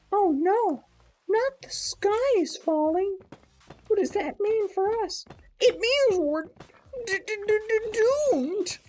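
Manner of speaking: high squeaky panic with stutter; melodramatic panic. Acted. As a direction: squeal it like a doomed little bird. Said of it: "a bit of a melodramatic rooster".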